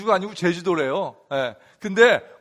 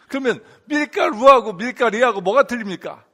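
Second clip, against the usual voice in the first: louder, mock-heated question; exasperated demand; 6 semitones higher, 10 dB louder than usual. Newlywed couple pressing the village head, a hammed-up loud demand. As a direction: push back at the village head in a hammed-up huff.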